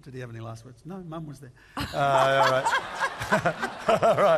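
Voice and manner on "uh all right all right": high, loud helpless laughter; cracking up, can't stop; laughing hard. Real, not acted. Cracks up after a joke.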